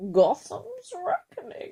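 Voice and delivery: slow, high masked villain; Bane impression. Shown as a demonstration. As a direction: intone it grandly, stretched out and high. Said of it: "to do my Bane impression".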